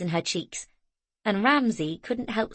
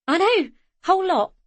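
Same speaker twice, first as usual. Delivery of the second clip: excited; 12 semitones higher, 6 dB louder than usual. A narrator's reading. Zoe excited about the sale.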